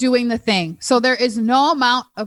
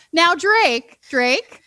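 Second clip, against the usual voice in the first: a fussy Minnesota mother calling her son; Minnesotan accent; 6 semitones higher, much slower than usual. Retold or imitated. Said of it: "you sound like a Minnesotan".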